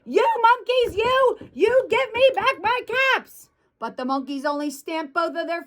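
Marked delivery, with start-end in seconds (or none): 0.1-3.5 high-pitched storybook outburst without real volume; hammed-up fury of an exasperated peddler; mock angry shout. A narrator's reading. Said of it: "and he shouted".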